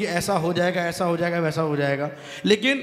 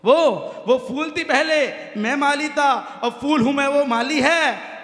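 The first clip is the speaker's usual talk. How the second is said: higher, louder, slow stage declaiming; loud declamation; 7 semitones higher, 4 dB louder, slower than usual. Shown as a demonstration. Poet declaiming his couplet loudly to the audience. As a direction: belt the couplet out to the crowd. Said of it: "तब पंक्ति कहता हूँ".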